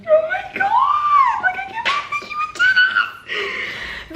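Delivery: very high shriek trailing into laughter; overwhelmed fan losing it; real stunned squealing. Real, not acted. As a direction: scream it, then squeak out the rest. Screaming at shocking book ending.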